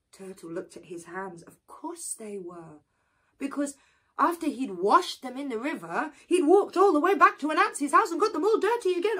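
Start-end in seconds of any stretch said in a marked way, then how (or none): none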